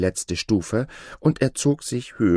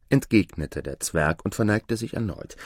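plain reading voice

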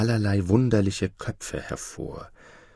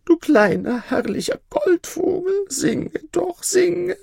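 much higher, tearful pleading cry; a frantic high plea, close to crying; begging emperor; 4 dB louder than usual. Acted. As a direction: beg the golden bird through tears. Dying emperor begs the bird.

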